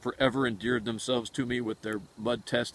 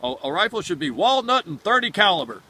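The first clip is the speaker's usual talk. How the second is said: declaring; 6 semitones higher, 9 dB louder than usual. Retold or imitated. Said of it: "That's what it was we'd say".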